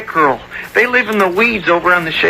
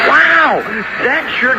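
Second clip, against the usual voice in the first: amazed wow; 7 semitones higher than usual. Acted. Boy watching the worker's saw cut fast, amazed. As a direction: gasp it out in delight.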